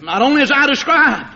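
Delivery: ordinary talk, nothing marked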